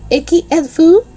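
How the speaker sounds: high, loud teacher question; stern teacher in French. A narrator's reading. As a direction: demand it like a strict teacher.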